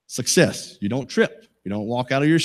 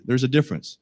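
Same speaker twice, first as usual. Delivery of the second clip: ordinary talk, nothing marked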